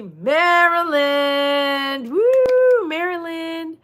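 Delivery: high, drawn-out cheer with a woo; chanted like a rallying cry; group cheer. Pretend. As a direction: lead the crowd in a long, ringing cheer. Leads viewers in group cheer.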